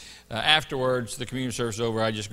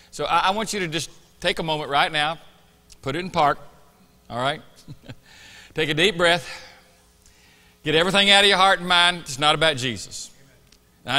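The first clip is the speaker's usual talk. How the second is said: rising, louder pulpit exhortation; preaching; 6 semitones higher, 7 dB louder than usual. Acted. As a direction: build it up like a preacher. Preaches before communion.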